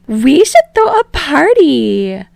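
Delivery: high, loud party pitch; high loud excited girl; excited Ashido. Acted. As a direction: pitch it up, thrilled.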